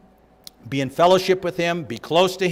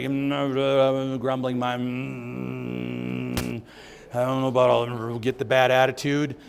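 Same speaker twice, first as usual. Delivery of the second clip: mock grumbling; 3 semitones lower, 5 dB quieter, slower than usual. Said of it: "The word means under your breath".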